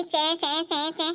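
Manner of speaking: slow mock "चै चै चै चै" for nagging; mocking chatter. Mother mimics daughter's nagging, slow and mocking. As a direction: drag out the chatter noise, teasing.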